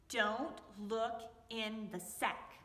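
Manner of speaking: drawn out slowly and low; slow warning. Acted. Foxy warning the woman about his sack, slow and stern. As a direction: stretch each word as a stern warning.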